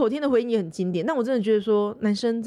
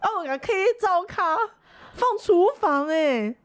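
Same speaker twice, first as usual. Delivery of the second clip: imitating the nurse; 8 semitones higher than usual. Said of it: "他说".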